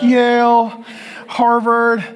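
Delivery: loud, high, drawn-out college names; proud announcing. Retold or imitated. Classmates showing off colleges for the photo, proud announcing. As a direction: call out each school grandly.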